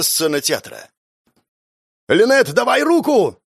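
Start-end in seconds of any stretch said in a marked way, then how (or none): 2.1-3.4 high urgent shout; yelling. Acted. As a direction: yell across the gap. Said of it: "орал Альберт".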